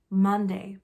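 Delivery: plain reading voice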